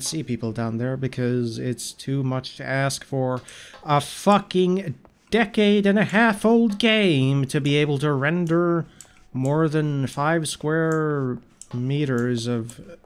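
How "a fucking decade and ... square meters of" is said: loud, slow, mocking rant; exasperated rant. Real, not acted. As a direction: drag it out in loud disbelief. Ranting about old game.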